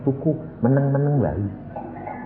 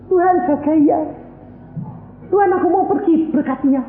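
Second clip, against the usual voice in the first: higher, whiny voice ordering God; mock bossy prayer; 14 semitones higher than usual. Preacher mocks a believer's prayer before travelling, higher whiny voice. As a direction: play a believer treating God as a guard. Mocking a demanding congregant prayer.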